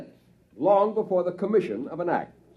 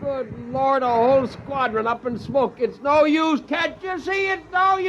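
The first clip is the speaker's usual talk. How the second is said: desperate; 8 semitones higher than usual. Acted. Jets destroyed, despair.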